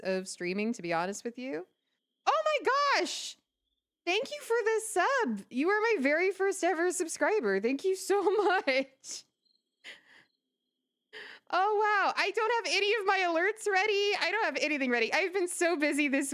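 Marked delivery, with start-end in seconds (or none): 2.3-14.1 high, delighted squealing thanks; excited squeal. Real, not acted. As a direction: gush with surprised joy. First ever subscriber, overjoyed.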